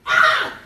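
sudden breathy high shriek; gasp-scream at the scare; startled scream. Real, not acted. Jump scare.

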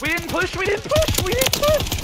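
frustrated yelling. Real, not acted. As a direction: yell it in disbelief. Teammates arguing mid-fight.

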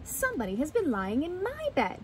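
high storybook mother bear; Mama Bear. Acted. Mama Bear discovers her bed used, high mother bear voice. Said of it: "cried Mama Bear".

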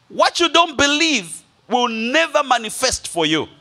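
ordinary talk, nothing marked

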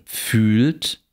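"fühlt" with a long close ü; long tense vowel. Shown as a demonstration. Lecturer reads minimal pairs, long tense vowel. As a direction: draw out the ü in "fühlt".

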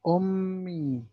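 أمي with the meem held and doubled. Shown as a demonstration. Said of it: "مشدد، بنطقه مرتين". (الميم مشددة تنطق مرتين)

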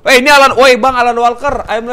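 loud, high starstruck call; excited shout. Real, not acted. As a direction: holler at a celebrity you just spotted. Spots [name], calls out.

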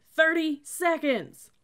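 loud, high, drawn-out yell; boomed countdown. A narrator's reading. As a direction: stretch the time call into a shout. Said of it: "boomed the cadet".

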